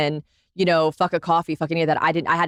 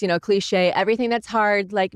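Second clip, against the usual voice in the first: ordinary talk, nothing marked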